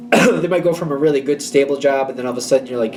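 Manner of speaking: ordinary talk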